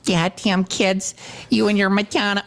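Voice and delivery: put-on grouchy parent scolding kids; mom voice. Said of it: "I'm talking like my mom now".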